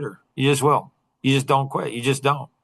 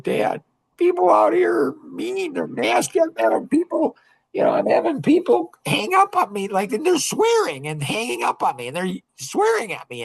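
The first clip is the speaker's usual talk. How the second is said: put-on much higher, whiny voice of an upset young girl; imitating crying daughter. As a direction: play the tearful twelve-year-old complaining to dad. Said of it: "she calls me up and she's crying".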